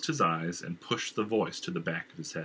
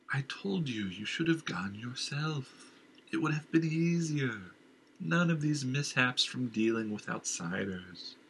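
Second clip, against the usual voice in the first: slow, eerie higher voice chiding the king; the dark voice in his head scolding; uncanny nagging voice; sinister inner voice; 5 semitones higher than usual. Acted.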